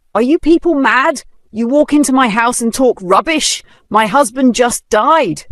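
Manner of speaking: high, loud outraged cry; angry shout. Acted. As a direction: yell it at them in disbelief. Widow furious at bank men.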